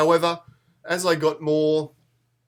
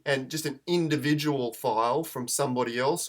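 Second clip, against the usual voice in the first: ordinary talk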